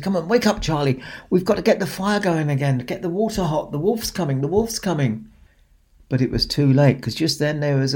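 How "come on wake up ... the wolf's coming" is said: urgent. A narrator's reading. Pig urgently wakes Charlie.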